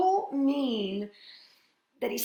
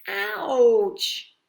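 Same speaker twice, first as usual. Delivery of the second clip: mock ouch; 3 semitones higher, 9 dB louder than usual. Cow steps on his foot.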